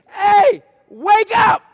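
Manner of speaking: high, loud panicked yell; frantic shout. Acted. Disciples yelling at sleeping Jesus in panic. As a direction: shake him awake with your voice.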